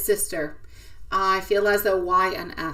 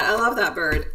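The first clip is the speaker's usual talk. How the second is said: usual speaking voice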